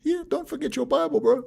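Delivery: put-on friendly Maori cop reminder in a Maori accent. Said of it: "talking in their quiet accent".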